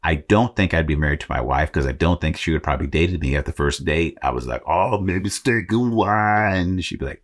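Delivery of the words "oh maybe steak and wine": very slow, higher mock Austrian accent; Arnold Schwarzenegger voice. Imagines asking his wife out as Arnold, slow Austrian accent. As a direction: woo a first date in a terrible Austrian accent. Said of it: "terrible Arnold Schwarzenegger impersonation".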